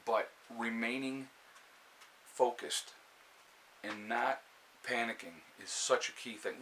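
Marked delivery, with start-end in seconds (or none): none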